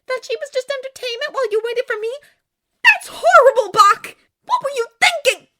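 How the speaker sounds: high, very loud outrage; high-pitched indignant shouting; furious scolding. A narrator's reading. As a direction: berate him at full volume. Acted quarrel, berating Buck.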